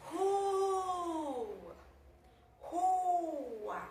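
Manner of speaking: "Rua" with a long, high RUUU gliding into A; stressed syllable stretched. Shown as a demonstration. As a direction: hold the U of "Rua" to show the stress. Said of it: "Vamos analisar a sílaba tônica". ("Rua" com o U tônico bem esticado e agudo)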